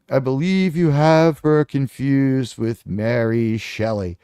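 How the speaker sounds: slow, low and prim; condescending correction. Retold or imitated. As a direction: correct him like a patient professor. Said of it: "And I'm like".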